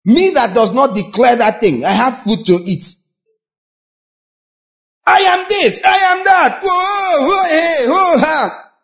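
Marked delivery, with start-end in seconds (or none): 5.0-8.7 high, shouting mock declarations; mock loud declaring. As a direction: ham up a believer shouting claims.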